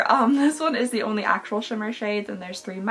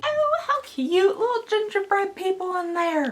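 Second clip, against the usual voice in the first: cooing; 8 semitones higher than usual. Real, not acted. Seeing tiny gingerbread figures.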